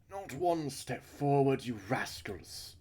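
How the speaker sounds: high, loud, slow scolding; Missus Burns. Acted. Missus Burns stopping rascals at the dressing-room door, scolding. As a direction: halt the troublemakers with a drawn-out high order. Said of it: "I'm fucking her voice up".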